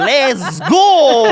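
slow, loud, high cheer; excited. Retold or imitated. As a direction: hype yourself up, laughing. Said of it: "je suis comme".

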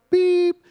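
high, loud mock bleep; censor beep. Son's swear word, as a high censor beep. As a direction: imitate the bleep that covers a swear.

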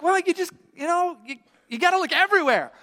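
high-pitched, insistent explaining; acted flustered parent; exasperated parent. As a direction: explain to a fretting child, losing patience. Parents answer the frustrated son.

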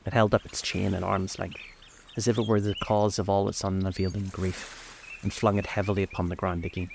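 ordinary delivery

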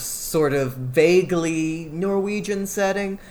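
slow down and lean on "vaguely", hedging; stress on vaguely. Real, not acted. Podcaster describing the film's changed setting, stress on "vaguely". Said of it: "emphasis on the vague".

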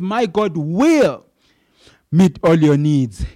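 My God will stretched slow, then meet all your needs hit loud; drawn out with weight on will, then a strong finish; emphatic, slow quote. Retold or imitated. Preacher voicing Paul's promise, emphatic and slow. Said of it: "he's so emphatic".

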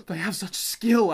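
mock dramatic. Co-host voicing Speedwagon monologuing while swimming, mock dramatic. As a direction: gush it like an overdramatic onlooker. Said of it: "like, dramatically".

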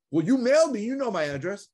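imitating an annoyed caller; indignant caller. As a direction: play the huffy homeowner. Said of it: "She goes".

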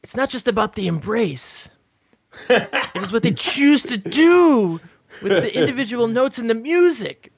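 Bat's voice. Retold or imitated. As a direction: read it the way Bat talks, slowly. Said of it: "I wanna read it in Bat's voice".

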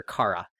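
Kara said as "KAH-ra"; Kah-ra pronunciation. Shown as a demonstration. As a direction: say Kara with an open "ah". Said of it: "very adamantly calling her".

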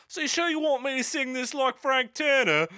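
high, loud put-on London accent; mock West X accent. Podcaster retelling studio chatter, mock West X accent. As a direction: ham up a cockney singer's question. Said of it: "Ask me that in a West X accent".

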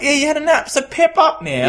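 high-pitched mock-exasperated retort; exasperated protest. Host answering his mate's nap excuse, exasperated and high-pitched.

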